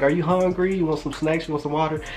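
hospitable mom. Retold or imitated. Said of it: "She was like".

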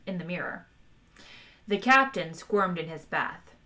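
ordinary delivery, nothing marked

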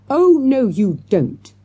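loud, high-starting command that drops; thundered. A narrator's reading. Teacher stopping children in their tracks, thundered. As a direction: stop them with a booming order. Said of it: "thundered Mister Jones".

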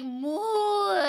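a high, drawn-out cow moo; playful cow moo to win them over. Pretend. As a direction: moo like a needy little calf. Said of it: "And I can just be like".

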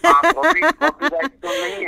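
mocking high-pitched echo of her words; squeaky high voice. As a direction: pipe it up in a silly squeak. Mock repeating the host's question.

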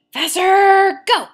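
full-voice yell of effort; shout. Real, not acted. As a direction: bark it out to push harder. Pushing herself through workout.

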